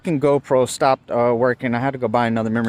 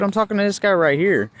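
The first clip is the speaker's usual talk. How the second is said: high, indignant retelling; retold cussing out; 9 semitones higher than usual. Driver to interrupting cop, mildly heated retelling. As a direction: re-enact setting an officer straight. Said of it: "I ended up cussing this one out".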